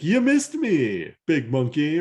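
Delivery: high, slow, singsong taunt. Retold or imitated. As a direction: gloat at the big monkey. Jetstorm taunting Optimus Primal.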